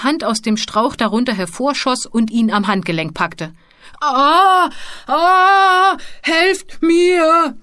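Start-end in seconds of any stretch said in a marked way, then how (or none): 4.0-7.6 high, loud drawn-out scream; screaming for help. Acted. Mumpo grabbed by the wrist over the chasm, screaming. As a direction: scream out in alarm, stretching the cries. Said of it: "schrie Mumpo".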